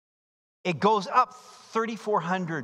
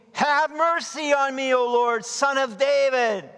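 crying out; 8 semitones higher, 4 dB louder than usual. Acted. Blind men crying out.

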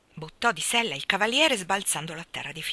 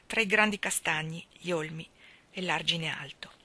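ordinary delivery, nothing marked

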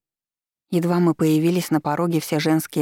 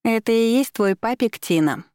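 high, taunting jeer; mocking; 6 semitones higher than usual. A narrator's reading. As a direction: sneer it across the room. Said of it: "насмешливо долетело с дивана".

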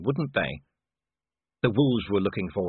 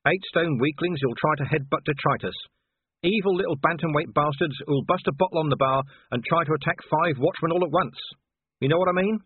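higher, insistent watchman's rant; agitated gruff husband; 6 semitones higher, 3 dB louder than usual. A narrator's reading. Vimes explains Wolfgang to Sybil, agitated rant. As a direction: rant about a stubborn type of fighter. Vimes rants to his wife.

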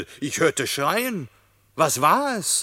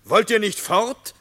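impatient calling; 6 semitones higher, 6 dB louder than usual. A narrator's reading. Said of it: "Ungeduldig begann Bellin am Tore zu schmälen".

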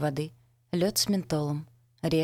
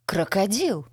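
sudden surprised exclamation, higher; startled near-cry; 4 semitones higher, 4 dB louder, slower than usual. A narrator's reading. Narrator spots the man from her past, startled near-cry. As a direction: blurt it out in surprise, just short of shouting. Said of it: "Чуть не крикнула от неожиданности".

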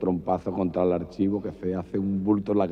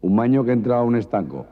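usual speaking voice

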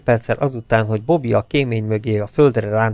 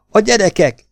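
higher, louder alarmed cry; horrified; 10 semitones higher, 5 dB louder than usual. A narrator's reading. Elin frightened for the children during gunfire, alarmed. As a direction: cry out in sudden dread. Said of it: "rémüldözött mellette Elin".

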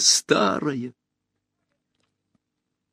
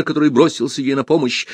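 ordinary delivery, nothing marked

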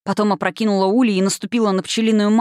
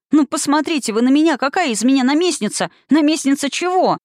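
high-pitched, agitated questions; frightened protest; 7 semitones higher than usual. A narrator's reading. Scared girl argues with the witch, high agitated protest. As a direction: protest incredulously, pitch jumping up. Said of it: "запротестовала перепуганная девочка".

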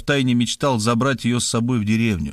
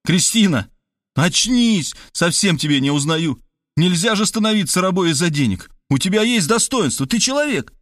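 raised shout; 7 semitones higher than usual. A narrator's reading. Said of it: "закричал".

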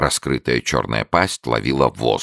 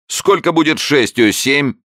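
quizzing showman; 13 semitones higher, 4 dB louder than usual. A narrator's reading. Showman quizzing his newt, high loud barker. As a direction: test the beast for the crowd.

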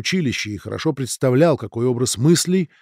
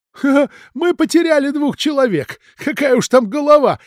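much higher, giggling through the words; nervous giggle; 14 semitones higher, 7 dB louder than usual. A narrator's reading. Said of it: "нервно захихикал Раневский".

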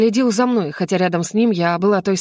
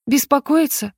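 higher-pitched startled echo of БЕСПОКОИТЬСЯ; surprised echo question; 6 semitones higher than usual. Acted. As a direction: repeat it back as a puzzled question. Heroine echoes his word back surprised. (удивлённо переспрашивает)

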